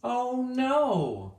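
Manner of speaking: high, loud cry of dismay; dismayed bunny. Acted. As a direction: squeal it like an upset bunny. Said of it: "I said".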